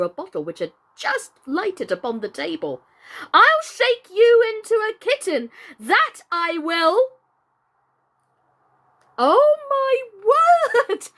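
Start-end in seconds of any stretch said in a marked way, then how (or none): 3.3-7.1 high, loud, fierce threat. A narrator's reading. Alice shaking the tiny Red Queen, loud fierce threat. 9.2-11.1 very high, loud, slow shout; excited exclamation. Real, not acted. Reader reacting to the chapter's end, excited shout. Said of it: "what a moment to end that chapter".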